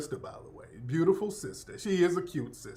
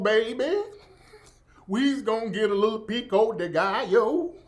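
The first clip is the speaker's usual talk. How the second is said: exaggerated Southern accent; 3 semitones higher than usual. Streamer mimicking a cooking influencer, exaggerated Southern accent. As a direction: lay the Southern accent on thick, like a TikTok cook. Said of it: "What kind of accent is that?".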